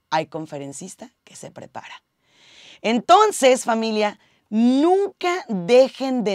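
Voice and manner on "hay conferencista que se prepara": hushed whisper to press the point. Real, not acted. Coach telling listeners the last tip, whispered. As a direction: breathe the line like a secret.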